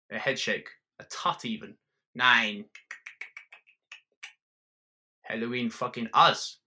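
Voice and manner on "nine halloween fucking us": a hint of a German accent, ranting; lightly voiced German bar owner. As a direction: swagger through the rant.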